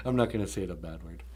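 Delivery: ordinary delivery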